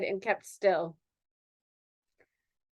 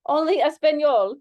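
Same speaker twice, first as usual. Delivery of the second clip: put-on louder, stronger Spanish accent; 5 semitones higher, 6 dB louder than usual. Dancer claims she speaks only Spanish, strong accent. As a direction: push the accent harder. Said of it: "I need to change my accent right now".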